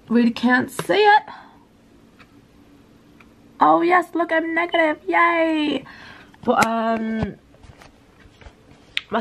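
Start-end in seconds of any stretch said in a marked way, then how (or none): 3.6-5.8 high, happy cheer; excited. Real, not acted. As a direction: celebrate the negative test. Happy about negative test.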